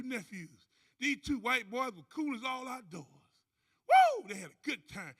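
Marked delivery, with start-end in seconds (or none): 3.9-4.3 high, loud party whoop. Acted. Partying with the judge's nephews, loud whoop.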